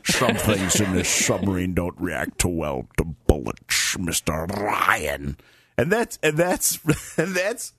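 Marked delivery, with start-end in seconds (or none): 0.0-5.4 put-on slow, slushy Scottish accent with the s turned to sh; Sean Connery Scottish accent. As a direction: mimic a Scottish-accented submarine captain's threat, drawn out. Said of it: "a goddamn Russian with a Scottish accent".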